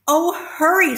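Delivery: the pig urges the rat on; urgent hurry. Acted. Wilbur urging the rat.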